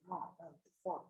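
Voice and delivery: angry curse muttered under the breath. Retold or imitated. Annoyed by a client's texts, muttering an angry curse. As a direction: mutter it, annoyed, barely aloud. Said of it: "I was, like, really angry".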